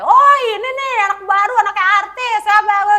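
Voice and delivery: put-on high, loud taunting shout at the new kid; mocking yell. Seniors jeering at the new kid, high loud taunting shout. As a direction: jeer loudly like seniors at the gate. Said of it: "mereka tuh teriak-teriakin gue".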